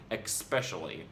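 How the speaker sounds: "especially" as ex-PESH-uh-lee. Real, not acted. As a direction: put a k sound into "especially". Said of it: "just like I say".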